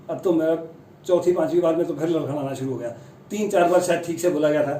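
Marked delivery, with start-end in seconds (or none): none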